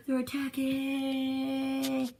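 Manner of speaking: high, very drawn-out cartoon wail; alarm cry. Fleeing character raises the alarm at the base, drawn-out wail. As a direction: stretch the last word into a high mock scream.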